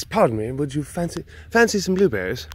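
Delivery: put-on polite British accent. Offering someone blueberries, put-on British accent. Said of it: "say it in a British accent".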